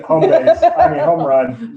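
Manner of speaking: laughing correction. Real, not acted. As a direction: crack up while fixing the slip. Laughing at own slip.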